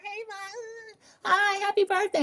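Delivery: high, drawn-out imitation of little kids; high singsong mock kid voice. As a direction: mimic children calling out birthday wishes. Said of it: "four little kids in pajamas".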